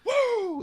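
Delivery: high-pitched mock whoop; excited whoop. Retelling a moviegoer's reaction to Adam Warlock, excited whoop. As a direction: imitate a thrilled fan's squealed woo. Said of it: "he was so excited".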